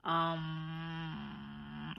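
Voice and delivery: reluctant. Retold or imitated. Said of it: "Eleanor was like".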